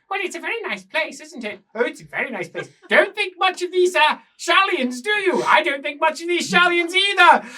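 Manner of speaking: put-on high prim English accent, growing loud; genteel English gossip turning indignant; two rat-men mimicking posh English voices. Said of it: "with accents that don't sound".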